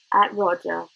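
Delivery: plain reading voice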